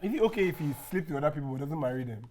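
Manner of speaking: ordinary talk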